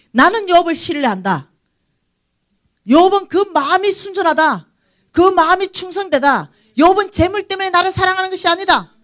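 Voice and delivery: proud voice of God. Acted. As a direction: proclaim it like God boasting of Job. Preacher voicing God's declaration.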